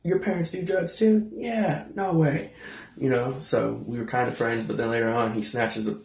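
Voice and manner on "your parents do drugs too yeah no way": higher-pitched mock surprise; high, incredulous retelling of a chat; retold disbelief. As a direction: imitate kids reacting in disbelief. Retold surprised exchange between kids.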